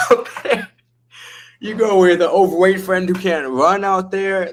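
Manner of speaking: ordinary delivery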